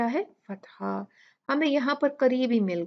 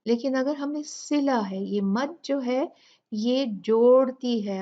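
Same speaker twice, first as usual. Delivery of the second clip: usual speaking voice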